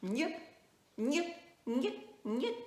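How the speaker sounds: quick "niou" repeated, rising each time; teacher repeating "niou"; syllable drill. Shown as a demonstration. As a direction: chant "niou" in a row for learners. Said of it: "deux sons, pareil".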